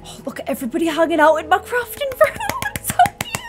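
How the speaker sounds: high, quick gush rising into a squeal; delighted squeal. Real, not acted. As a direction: squeal with delight at something adorable. Delighted squealing over the scene.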